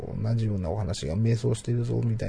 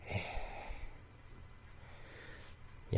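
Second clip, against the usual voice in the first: ordinary talk, nothing marked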